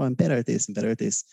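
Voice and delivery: ordinary talk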